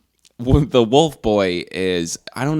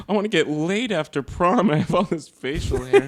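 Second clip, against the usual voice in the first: mock pleading kid; 7 semitones higher than usual.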